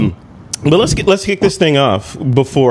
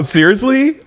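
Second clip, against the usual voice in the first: surprised; 10 semitones higher than usual. Real, not acted. Host hears of toilet paper hunt.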